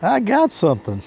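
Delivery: high, eager mock call of a hooked fish; light imitation of someone yelling they've got a bite; imitating excited anglers. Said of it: "And they're like".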